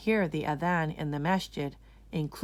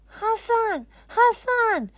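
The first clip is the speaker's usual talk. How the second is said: high, loud calling of a name; shouting; 7 dB louder, slower than usual. Acted. Anisa calling to her brother through the window, shouting. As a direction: call out across the hall. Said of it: "Anisa shouts".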